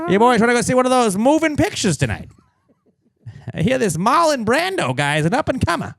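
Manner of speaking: put-on high, loud old-timey announcer voice; 1920s Midwestern accent. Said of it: "nineteen twenties Midwestern American accent".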